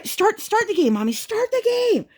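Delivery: put-on very high, insistent toddler wail; screaming child. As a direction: imitate a kid screaming at mommy. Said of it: "Just screaming for an hour straight".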